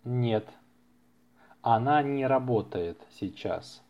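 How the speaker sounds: ordinary talk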